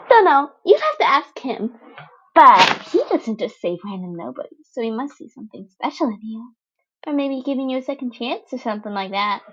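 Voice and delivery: put-on gleeful teen villain chirping at the wounded boy; bubbly villain girl Toga. Toga voiced playful.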